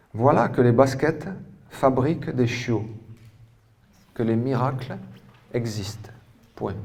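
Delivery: phrase-by-phrase classroom dictation, punctuation spoken; projected, deliberate teacher-style reading. Real, not acted. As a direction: dictate clearly, leaving long gaps between phrases. Reads out a dictation.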